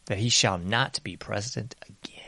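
hushed, breathy aside; conspiratorial whisper. Real, not acted. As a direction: drop to a whisper for effect.

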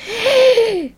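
mock gasp. Church erupts at her arrival.

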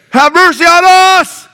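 high drawn-out shout from afar; loud voice. Acted. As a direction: cry out slowly at full volume, begging for mercy. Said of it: "they have to say with a loud voice".

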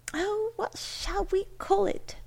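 put-on high, light girlish voice; eager daughter. A narrator's reading. Delighted daughter asks for a name.

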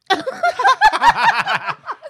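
shrieking burst of laughter; loud high laugh. Real, not acted. Laughing at own story.

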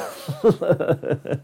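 short chuckle; brief chuckle to himself; laugh. Real, not acted. Reviewer amused by his own cartoon quote, short laugh.

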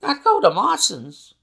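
high, loud disbelief; astonished question. A narrator's reading. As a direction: echo it back in shock. Clerk is astonished by the suggestion.

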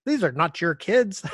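hammed-up disbelief; mock outraged. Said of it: "he's like".

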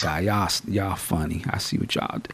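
low, hushed whisper to himself; knowing aside. Retold or imitated. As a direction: whisper it like you caught them. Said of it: "I was like".

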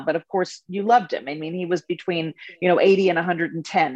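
ordinary delivery

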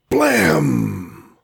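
drawn-out high "Blam!" falling away; stretched comic-book blast; booming blast imitation. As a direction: voice the explosion as one long falling cry. Explosion in the story.

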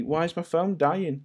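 a hint of a whiny child; imitating daughter. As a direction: play the puzzled kid asking dad. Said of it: "She's asking me".

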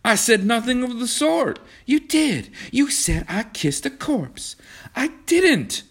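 high, heated back-and-forth squabble; risen voices. A narrator's reading. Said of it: "Their voices had risen".

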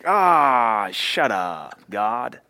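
a hint of the gruff bulldog, dismissive; lightly growled brush-off; grumpy bulldog. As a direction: believer answering God like the bulldog. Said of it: "the bulldog that looks at God and says".

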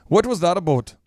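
Minnesotan "about", said like "a boat"; Minnesotan accent. Shown as a demonstration. Showing how Minnesotans ask, "about" as "a boat". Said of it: "but it's more like a boat".

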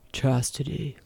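hint of a man's rumbling voice; soft, lightly voiced low rumble. A narrator's reading. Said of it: "low rumble of his voice repeats".

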